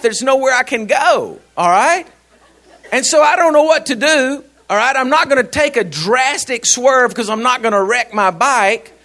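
normal talking voice

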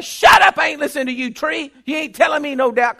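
loud, high, scolding yell; telling off a tree. Retold or imitated. Preacher yelling at a tree to silence doubt, angry. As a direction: snap back at the doubt. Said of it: "anybody that's around me is like".

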